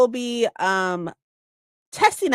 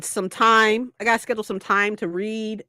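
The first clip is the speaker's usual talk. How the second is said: ordinary talk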